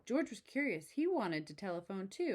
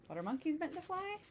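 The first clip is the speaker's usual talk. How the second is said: curious monkey thought; 10 semitones higher than usual. Acted. George's thought voiced in story.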